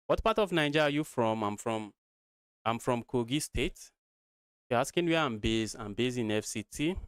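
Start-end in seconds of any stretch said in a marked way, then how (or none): none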